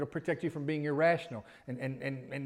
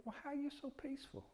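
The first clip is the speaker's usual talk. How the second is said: high, much softer marvelling question; amazed onlooker; 10 semitones higher, 10 dB quieter than usual. Retold or imitated. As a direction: marvel at the calm of the believer. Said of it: "they'll say".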